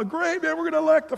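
high, excited cheering voice; mock cheering fan. Mocking rah-rah evangelicals, high and excited. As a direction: cheer like a gushing supporter.

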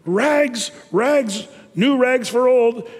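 slow peddler's cry; calling out. Acted. Ragman calls out wares in the street.

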